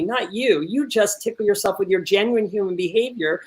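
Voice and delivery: normal talking voice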